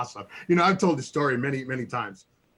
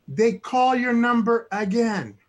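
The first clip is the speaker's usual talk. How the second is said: building suspense; 8 semitones higher, 4 dB louder, slower than usual. Real, not acted. Building to the funny part.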